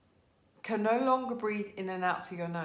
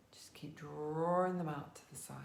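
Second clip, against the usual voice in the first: whispering; 2 semitones lower, 5 dB quieter than usual. Acted. Quiet yoga cue.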